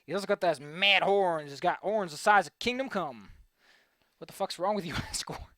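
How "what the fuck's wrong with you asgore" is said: ordinary talk, nothing marked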